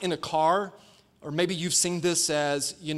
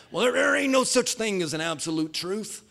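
higher-pitched put-on country accent; 4 semitones higher than usual. Pastor voicing skeptics who doubt absolute truth, country accent. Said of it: "do this in a country accent".

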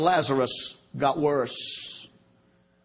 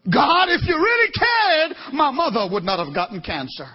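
bitter cry at God; 9 semitones higher, 3 dB louder than usual. Retold or imitated. Quoting a bitter complaint to God.

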